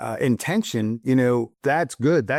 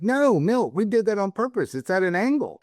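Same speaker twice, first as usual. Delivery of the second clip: higher, quick defensive explanation; explaining; 8 semitones higher than usual. Retold or imitated. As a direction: hurriedly set him straight, a bit exasperated. Retold own reply to grandfather.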